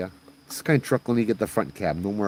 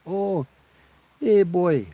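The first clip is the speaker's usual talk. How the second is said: high, louder auntie call; old Hawaiian auntie; 10 semitones higher, 4 dB louder, much slower than usual. Acted. Old lady greeting young driver by the road, high auntie voice.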